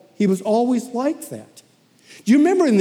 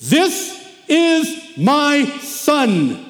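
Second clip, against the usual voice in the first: very slow, higher and louder pronouncement; voice of God; 5 semitones higher, 3 dB louder, much slower than usual. Retold or imitated. God speaking from the clouds to the disciples, solemn and slow. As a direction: boom it out from the clouds like heaven speaking. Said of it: "And the voice said this".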